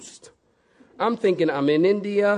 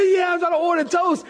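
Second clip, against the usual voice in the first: high, eager rush of words; excited reply; 11 semitones higher than usual. Retold or imitated. Traveler answering fellow English speaker, excited. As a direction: blurt it out with huge relief.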